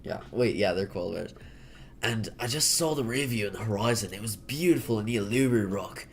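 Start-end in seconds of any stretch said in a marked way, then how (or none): 2.0-6.1 hammed-up Aussie accent; mock Australian accent. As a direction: play an Australian recounting a trip. Continues the Australian act.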